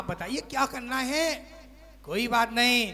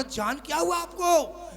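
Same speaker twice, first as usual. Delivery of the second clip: higher, coaxing "जान क्या हुआ आपको"; sweet-talking husband; 7 semitones higher than usual. Acted. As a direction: coax her like a doting husband. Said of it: "बोलता".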